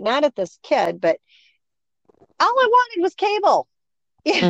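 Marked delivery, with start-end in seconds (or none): 2.4-3.7 high-pitched exasperated mock wail; comically aggrieved, pitched way up; mad. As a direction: replay the frustration in a much higher voice. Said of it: "I was so mad that night".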